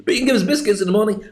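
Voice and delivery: quick, pleading pet voice; a dog wheedling for treats. Pretend. Dog pleading with owner for biscuits, hurried.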